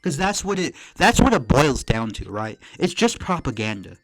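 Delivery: loud, heated ranting; angry rant. Real, not acted. Streamer rants at Hasan over his diploma, loud and angry.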